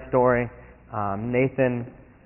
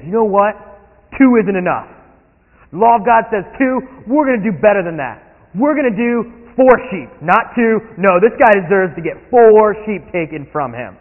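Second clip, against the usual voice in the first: loud, higher zealous demand; angry David; 5 semitones higher, 6 dB louder than usual. Acted. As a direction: outdo the law in anger. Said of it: "David is so angry".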